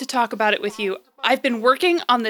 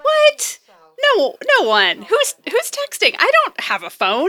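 high, loud startled yelp into stammered denial; flustered denial; 9 semitones higher, 4 dB louder than usual. Acted. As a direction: caught out, protest too much. Caught secretly texting, denies it.

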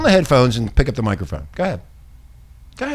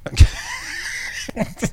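high, airy laughing fit; 4 dB quieter than usual. Real, not acted. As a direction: crack up in a high wheeze. Cracking up at the joke answer.

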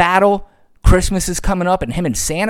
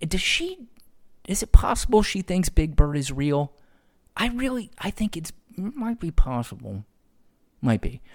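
hushed aside; 3 semitones higher, 6 dB quieter than usual. Real, not acted. Conspiratorial aside about Greene.

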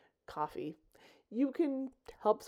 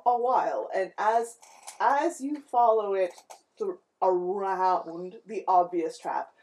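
louder scene-setting narrator voice; game master narration; 3 semitones lower, 6 dB louder than usual. Acted. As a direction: set the scene for the party.

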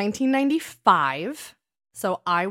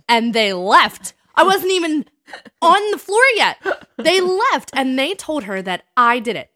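rising indignant outburst, higher and louder; outraged; 5 semitones higher, 7 dB louder than usual. Real, not acted. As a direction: let the injustice burst out loud and high. Outraged retelling of being framed.

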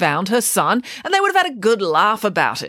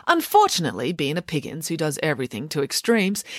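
usual speaking voice

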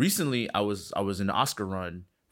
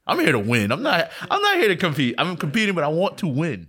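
louder and higher, firmly insisting; determined declaration; 8 semitones higher, 8 dB louder than usual. Real, not acted. A filmmaker telling the host he wants to win, loud and firm. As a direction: drive it home like a competitor. Insists on winning, rising force.

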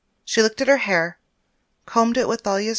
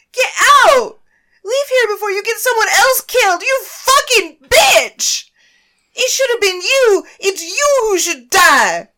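much higher, louder hateful shriek; furious scream; 14 semitones higher, 12 dB louder than usual. Acted. Naomi screaming at Paris to leave, furious. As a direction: scream her out of the house.

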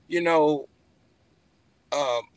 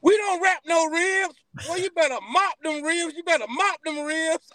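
mama voice; 10 semitones higher than usual. Retold or imitated. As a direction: voice his mama telling him off. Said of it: "She like".

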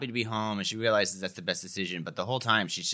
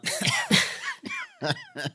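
high squeaky suppressed laugh; stifled giggles. Real, not acted. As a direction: choke back a laugh with coffee in your mouth. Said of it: "[name]'s trying out a new laugh".

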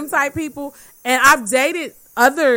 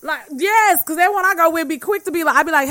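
ordinary talk, nothing marked

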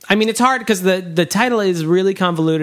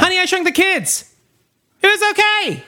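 high voice; 13 semitones higher than usual. Pretend. Mock high voice review.

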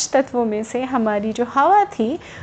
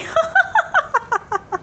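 high, gloating cackle; a mocking villain's laugh; loud laugh. A narrator's reading. Wind laughs at the sun, high gloating cackle. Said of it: "ज़ोर ज़ोर से हंसने लगी".

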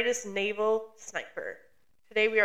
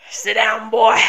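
mock command; 9 dB louder than usual. Playful mock command back.

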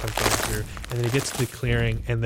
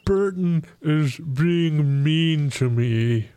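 higher, slow, sulky ogre voice; whiny ogre; 4 semitones higher, much slower than usual. Acted. Ogre complaining to the party about bullies, whiny. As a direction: whine it like a hurt giant.

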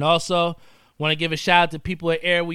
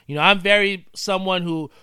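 usual speaking voice

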